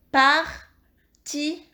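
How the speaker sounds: "Partition" in three slow, separate syllables; syllable-by-syllable dictation. Shown as a demonstration. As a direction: dictate "Partition" slowly, pausing as par, ti, sion. Teacher rereads word by syllables.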